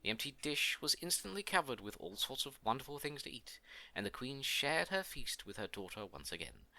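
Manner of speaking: ordinary delivery, nothing marked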